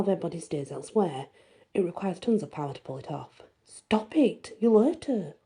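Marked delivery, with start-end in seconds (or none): 3.6-5.3 urgent, raised protest; angry. A narrator's reading. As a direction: snap at him to stop. Narrator confronts Alec angrily.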